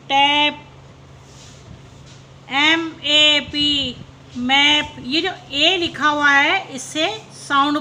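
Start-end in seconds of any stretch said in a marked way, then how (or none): 2.5-4.0 slow letter-by-letter spelling. Shown as a demonstration. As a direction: spell ए पी slowly, each letter apart. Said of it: "teacher spells word slowly".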